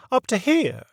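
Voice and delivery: put-on a proper old lady leading the inquiry; prim lady voice. A narrator's reading. Mary Mabel finishes tracing.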